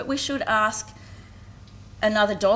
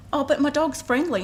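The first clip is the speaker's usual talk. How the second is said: higher, louder mock of a breezy shrug-off; dismissive dog owner; 7 semitones higher, 4 dB louder than usual. Mother retells the owner walking off, mock breezy. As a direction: wave it away as if nothing happened. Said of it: "And she said".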